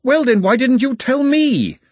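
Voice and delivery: loud, high angry outburst; shrill furious demand; exploding. A narrator's reading. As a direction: burst out at him in fury. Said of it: "She nearly exploded".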